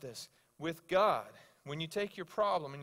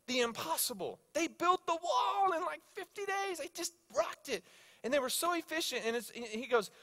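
high-pitched, exhilarated retelling; pumped; 7 semitones higher than usual. Retold or imitated. Coworker sharing how God's people built the wall, pumped. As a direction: marvel at it with the voice riding high. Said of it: "He was so pumped".

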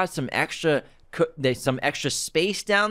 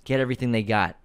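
ordinary talk, nothing marked

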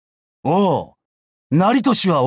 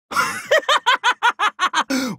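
high, rapid cackle; 高笑い. Acted. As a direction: burst into a long, falling cackle. Laughs at facing former lord. (甲高く笑い続ける)

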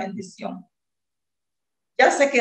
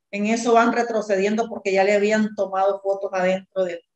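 ordinary talk, nothing marked

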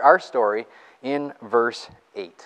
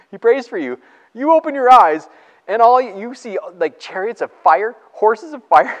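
excited; 10 semitones higher, 8 dB louder than usual. Real, not acted. Imagining seeing chariots of fire.

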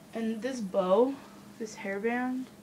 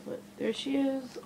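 usual speaking voice

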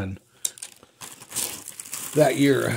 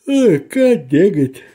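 annoyed exclamation; 5 semitones higher, 7 dB louder, slower than usual. Real, not acted. Something goes wrong while unpacking.

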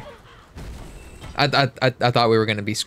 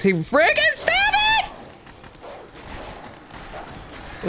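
very high, frantic shriek; high frantic yell; 4 dB quieter, faster than usual. Pretend. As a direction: screech it in a panic. Yelling at attacking dogs.

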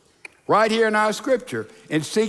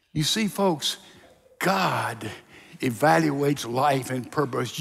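normal talking voice

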